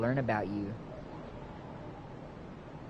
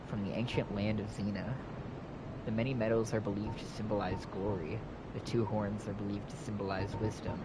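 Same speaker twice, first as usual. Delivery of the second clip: soft, even item lore read; 4 semitones lower, 7 dB quieter than usual. Acted. Player reading armor lore to viewers, soft and even. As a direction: read the description quietly and evenly.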